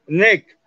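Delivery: NECK ending in a k-like stop; strong final G. Shown as a demonstration. Teacher explaining G in last place, hard-ended "neck". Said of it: "lo estoy poniendo de último".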